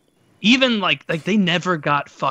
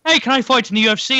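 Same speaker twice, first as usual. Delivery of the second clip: mock eager Conor; 9 semitones higher, 5 dB louder, faster than usual. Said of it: "then Conor came over".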